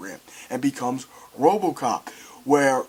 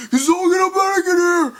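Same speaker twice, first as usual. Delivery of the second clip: panicked call; 13 semitones higher, 5 dB louder than usual. Retold or imitated. Panicked man calling.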